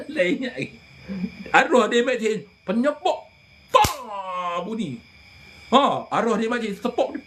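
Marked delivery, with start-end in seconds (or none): none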